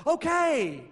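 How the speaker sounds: high, loud, drawn-out okay; breezy agreement. Retold or imitated. Jesus answers the servant's plea, breezily agreeable. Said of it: "And Jesus is like".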